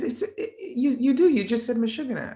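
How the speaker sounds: high-pitched stammering mock protest; flustered insistence. Classmate to the teller who denies it, flustered insistence.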